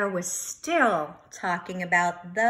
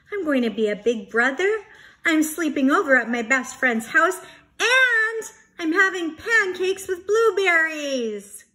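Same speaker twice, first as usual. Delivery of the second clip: fast, louder, rising with glee; excited; 8 semitones higher, 7 dB louder than usual. Acted. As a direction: gush it all in one happy breath. Bear can't believe his luck.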